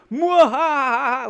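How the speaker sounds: high slow villain cackle; evil laugh. Pretend. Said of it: "I'll be like Zurg".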